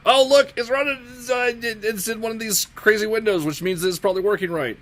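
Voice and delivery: high, loud, thrilled; surprised delight it works; excited. Real, not acted. Build finally runs.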